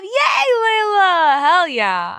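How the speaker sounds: loud, high, drawn-out yay; slow, shouted cheer. Real, not acted. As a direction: scream with joy for her.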